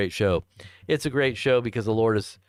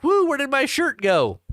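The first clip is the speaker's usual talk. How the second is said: high-pitched comic whoop and question; mock bewilderment; 13 semitones higher, 4 dB louder than usual. Host imitating someone who lost their shirt, high-pitched mock bewilderment. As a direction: play a baffled loser, voice shooting up. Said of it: "You're gonna be like".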